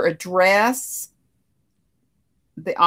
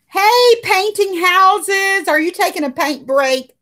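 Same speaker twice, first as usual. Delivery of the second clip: cheery greeting; 10 semitones higher, 5 dB louder than usual. Real, not acted. Greeting a viewer by name.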